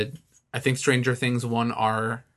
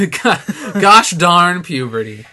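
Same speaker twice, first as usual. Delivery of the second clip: mock exasperation; 4 semitones higher, 8 dB louder, much slower than usual. Laughing mock complaint.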